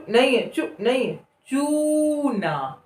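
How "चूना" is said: चूना with चू held long; slow drawn word. Shown as a demonstration. Teacher modelling चूना slowly. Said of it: "बोलकर देखें".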